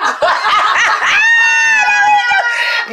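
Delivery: high, loud burst of startled laughter; startled laughing shriek. Real, not acted. Daughter startled her.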